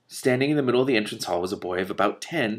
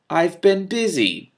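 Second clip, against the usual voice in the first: slow, high, louder protest; defensive; 9 semitones higher, 6 dB louder, much slower than usual. A narrator's reading. Magician defending himself to the witch, slowly defensive. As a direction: push back against the jab. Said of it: "Telemain said defensively".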